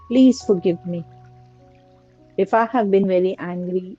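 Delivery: ordinary delivery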